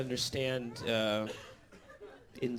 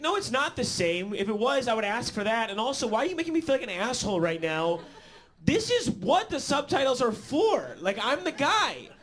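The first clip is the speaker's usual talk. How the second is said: high, heated protest building to a shout; acted outrage, climbing to a yell; indignant arguing; 8 semitones higher than usual. Acted argument with roommate.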